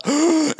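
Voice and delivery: sharp high gasp of shock. Retold or imitated. Boy caught by the shopkeeper, a shocked gasp. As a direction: gasp as if caught red-handed. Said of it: "et sjokk gikk gjennom meg".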